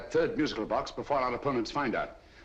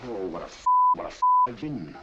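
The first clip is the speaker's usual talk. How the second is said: exasperated outburst; 7 semitones higher, 8 dB louder than usual. Acted. Realizes he was fooled.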